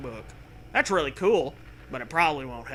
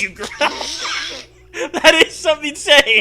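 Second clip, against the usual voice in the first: words breaking up in real laughter, much higher; laughing; 12 semitones higher, 11 dB louder than usual. Real, not acted. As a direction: laugh through it, barely getting it out. Cracking up at absurd line.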